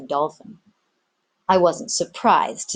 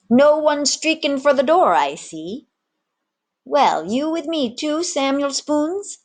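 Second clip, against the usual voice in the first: higher, louder rough pirate recruiting; a one-armed rogue selling gold to sailors; swaggering pirate mate; 9 semitones higher, 3 dB louder than usual. Acted. Pirate mate lures the tavern crew, loud and swaggering. Ginger taunts and asks Samuel.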